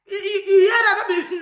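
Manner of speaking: slow laughing disbelief. Real, not acted.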